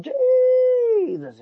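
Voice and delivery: very high, loud exasperated cry; high yelp of disbelief. Real, not acted. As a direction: throw it up in disbelief. Exasperated outburst.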